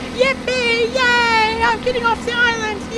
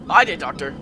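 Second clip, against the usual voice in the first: mysterious low voice. Acted. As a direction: speak low from the shadows. Unseen stranger answers.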